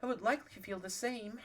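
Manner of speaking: a hint of an upper-class lady pleading; posh voice. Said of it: "I'm giving her a posh voice".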